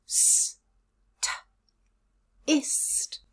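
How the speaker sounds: s, t, ist isolated sounds then blended; sounding out the second syllable. Shown as a demonstration. Teacher to learners, building "ist" from its sounds. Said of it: "Second syllable".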